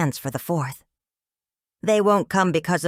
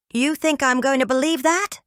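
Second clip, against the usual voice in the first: raised voice; 9 semitones higher than usual. A narrator's reading. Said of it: "raising his voice".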